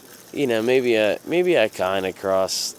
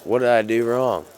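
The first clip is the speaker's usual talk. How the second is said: raised, protesting question; indignant; 5 semitones higher, 7 dB louder than usual. Retold or imitated. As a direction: push back indignantly. Protesting to the cop.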